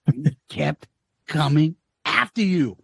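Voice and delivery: lightly voiced trainer, low and halting; Apollo Creed's manager. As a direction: warn it low, word by word. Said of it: "Apollo Creed's manager saying".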